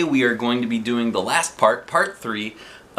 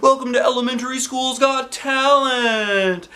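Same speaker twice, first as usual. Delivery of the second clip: high, bright showman's welcome; announcer voice; 11 semitones higher than usual. Acted. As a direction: announce it to the crowd. Principal announcing on stage.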